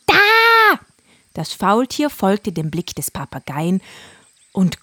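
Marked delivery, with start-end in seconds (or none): 0.1-0.8 high, loud parrot voice; a frantic parrot urging; excited parrot. Acted. Parrot voice held. (hohe, aufgeregte Papageienstimme)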